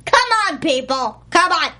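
loud, punchy mock impatience; Cloris Leachman. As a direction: hurry the crew along, imitating a bossy actress. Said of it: "is that your best Cloris Leachman impression?".

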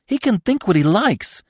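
high, strained, defiant cry; upset, high-pitched outburst. A narrator's reading. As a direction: cry out shrilly, past being calmed. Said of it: "she cried, bleating again".

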